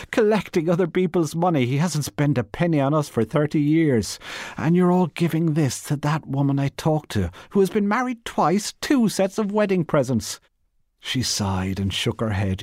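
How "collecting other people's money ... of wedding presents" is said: high, scornful crying-out; mocking cry. Missus Cork mocking her husband to Bernice, high and scornful. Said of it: "she cried".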